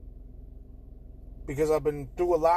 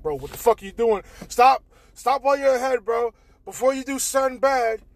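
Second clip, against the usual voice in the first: inner voice yelling; 8 semitones higher, 9 dB louder than usual. Retold or imitated. Stopping himself, inner voice.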